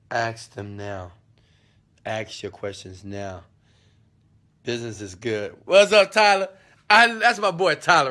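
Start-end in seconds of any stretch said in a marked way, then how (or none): 5.6-8.1 excited greeting. Real, not acted. Greeting a friend on the call.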